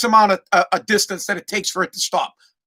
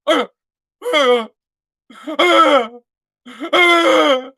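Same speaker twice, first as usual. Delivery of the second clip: mock stunned-kid grunts, rising higher and louder; speechless whimper; 6 semitones higher, 3 dB louder, much slower than usual. Kid frozen before the truck, mock speechless whimpers. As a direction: imitate a kid too shocked to speak. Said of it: "The kid couldn't say a word".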